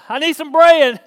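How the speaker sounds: loud, insistent plea; calling up. Acted. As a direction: shout your request up to a window. Caller shouts up to neighbour.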